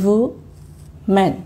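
"woman" pulled apart into wo and man with a long pause; two isolated syllables in "woman". Shown as a demonstration. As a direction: slowly demonstrate both parts of "woman". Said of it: "প্রত্যেকটি শব্দ এক একটা খণ্ড আকারে".

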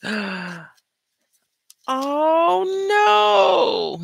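high, loud cry of no; mock horror. Hearing the grandson has red hair, mock horrified cry. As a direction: shriek it in playful dismay.

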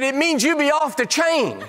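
put-on high, chuckling voice of young pastor; preacher retells young friend's slangy answer, chuckling; laughing Tommy.

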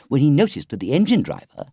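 ordinary delivery, nothing marked

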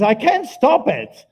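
high, loud and urgent; shouted quote. Retold or imitated. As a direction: cry it out over the pain. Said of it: "And she says".

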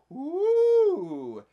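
loud high whoop; excited woo. Real, not acted. Reacting to Mark's shave, loud whoop. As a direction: cheer it out.

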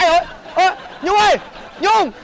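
calling out. Acted. Calling after girlfriend who left.

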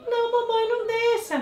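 very high, scared little puppy voice; Clarinha's voice. Acted. Dog Clarinha begging mom about the monster, high puppy voice. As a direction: whimper like a frightened puppy. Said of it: "fazer a voz da Clarinha".

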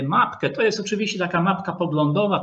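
ordinary delivery

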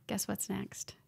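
hushed, eager whispered teaser; soft, excited build-up to a reveal. Real, not acted. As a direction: whisper it like a secret you can't wait to share. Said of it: "I got really excited about that".

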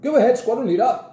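high, loud answering call; old officer calling back briskly; calling grandpa. A narrator's reading. Grandpa answering Jack from round the corner, calling out. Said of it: "came Grandpa's voice from round the corner".

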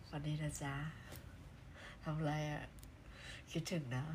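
low, hushed and intimate, as if right beside his ear; soft close-to-ear voice. Shown as a demonstration. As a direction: murmur softly into a fan's ear. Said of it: "เสียงแบบนี้ต้องบอกว่าต้องอยู่ข้างๆหู".

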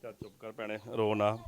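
low, hushed comforting; gentle consoling. Real, not acted. Reporter to a weeping woman, telling her not to cry, soft consoling. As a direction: soothe her softly, voice dropped low.

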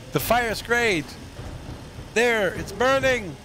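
high, slow excited gamer; panicking gamer. Real, not acted. Gamer watching fire in game.

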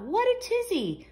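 ordinary delivery, nothing marked

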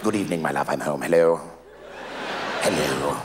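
put-on deep, soft, theatrically sober English accent; posh English accent. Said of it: "developed an English accent when I drink".

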